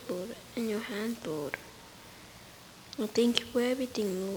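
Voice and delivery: ordinary delivery, nothing marked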